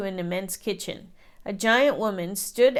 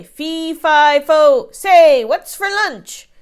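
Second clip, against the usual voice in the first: much louder fee fi fo chant; booming giant; 6 semitones higher, 11 dB louder, slower than usual. A narrator's reading. Giant announces himself coming for lunch. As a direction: boom it down the hallway.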